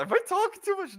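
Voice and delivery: high-pitched mock worried question; mock self-quote. Co-host replaying his own private chat question, high mock squeak. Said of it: "I said it in the private chat".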